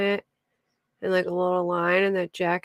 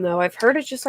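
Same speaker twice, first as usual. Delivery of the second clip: ordinary delivery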